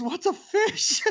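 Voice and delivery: a clueless office worker, puzzled; laughing, high-pitched imitation of a confused Casey; baffled Casey. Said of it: "Casey just goes".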